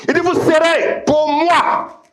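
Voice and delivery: high, drawn-out proclamation; full-force preaching. Real, not acted. Preacher proclaiming God's claim on the people, full-force preaching. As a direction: stretch it out high like a decree.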